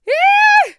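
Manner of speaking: piercing high-pitched squeal; long high shriek. A narrator's reading. Lady shrieking on seeing the bear policeman. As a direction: shriek in sudden fright. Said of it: "egy ilyen hosszú ít kiáltott".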